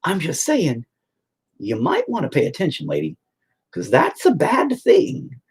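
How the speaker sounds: patient lecturing therapist, high and emphatic; emphatic explaining. Retold or imitated. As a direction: drive the point home, building. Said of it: "And I'm all".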